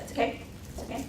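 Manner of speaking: quick hushed reassurance; soothing whisper. Retold or imitated. Teacher reassuring a struggling reader, whispered fast. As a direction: whisper comfort to the boy.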